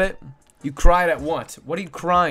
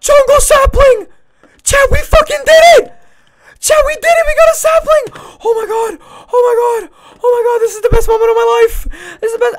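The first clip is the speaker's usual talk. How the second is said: very high, very loud shrieks of joy; ecstatic screaming; 11 dB louder than usual. Real, not acted. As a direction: scream it like you just won the lottery. Finally gets the sapling.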